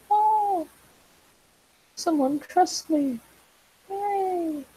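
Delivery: high, drawn-out little "aww" reaction; touched delight. Retold or imitated. Retelling her delighted reply to trust.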